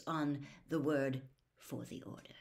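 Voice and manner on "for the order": very soft, breath-like afterthought; quiet taunt. A narrator's reading. Snape finishing his jibe at a rival, quiet taunt. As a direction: let the jibe drop to a whisper.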